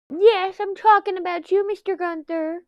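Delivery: high, loud pet-talk voice; sing-song squeal to a little animal, easing off in volume. Real, not acted. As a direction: coo loudly up high at a rabbit. Breaks off to address pet rabbit.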